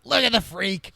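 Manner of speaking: high, loud heckle to a crowd; mock jeering shout. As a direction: shout it out like pointing at a sideshow. Acts Cicero jeering before crowd.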